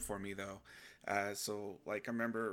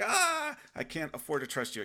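sudden high, loud yelp, then a frightened plea; hammed-up fright; startled scared outburst; mock panic; 7 semitones higher, 7 dB louder than usual.

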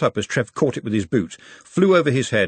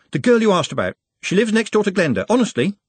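desperate; 10 semitones higher, 4 dB louder than usual. A narrator's reading. Nut desperately changes the subject.

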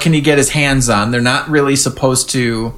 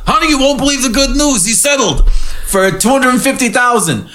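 Sandy impression; 8 semitones higher than usual. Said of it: "He's like".

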